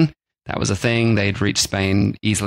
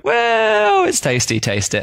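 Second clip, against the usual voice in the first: high, drawn-out mock-defensive start; farmers justifying themselves; slower than usual. Husbands coaxing their wives to taste, mock-defensive. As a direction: start high on a stretched word, then settle. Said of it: "They're like".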